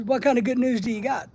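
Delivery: normal talking voice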